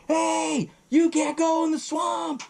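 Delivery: high, far-carrying yell of a warning; hollering at people heading for the swamp. Retold or imitated. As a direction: call out across the water to stop them. Guide warning tourists off swamp.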